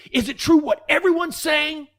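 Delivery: high, loud demanding shout; principal's yell. Retold or imitated. Principal storming out at the student, high shouted demand. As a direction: bellow it like an outraged principal. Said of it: "He goes".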